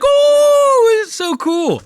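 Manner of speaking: high, loud drawn-out "oh" of delight; excited gushing. Retold or imitated. Fan recalling his reaction to the edit, excited gushing. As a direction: gush with a soaring, awestruck oh. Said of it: "I was like".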